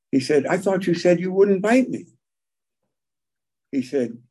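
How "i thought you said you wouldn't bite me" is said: high, stung protest; betrayed man. Retold or imitated. Bitten man protesting to the snake, high and hurt. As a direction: cry out in hurt disbelief.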